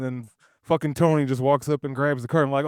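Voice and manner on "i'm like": normal talking voice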